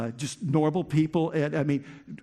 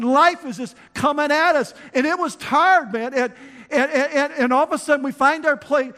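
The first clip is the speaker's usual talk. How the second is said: much louder and higher, pounding sermon voice; full-force preaching; 8 semitones higher, 7 dB louder than usual. Acted. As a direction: shout the struggle out to the church.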